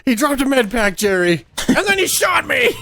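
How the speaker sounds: indignant outburst. Retold or imitated. Retelling being shot, indignant.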